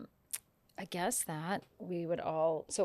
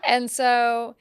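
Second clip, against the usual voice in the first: ordinary talk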